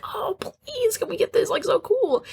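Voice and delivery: put-on high, pleading little-kid voice; high excited child begging at the store. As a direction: whine for the candy like a child. Child begging mom for candy.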